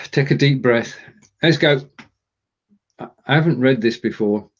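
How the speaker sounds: normal talking voice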